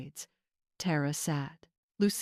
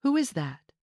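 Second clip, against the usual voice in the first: alarmed; 6 semitones higher than usual. A narrator's reading. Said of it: "eyes went wide".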